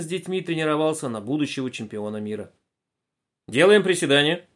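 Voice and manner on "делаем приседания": команда тренера. A narrator's reading.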